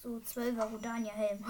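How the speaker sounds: ordinary talk, nothing marked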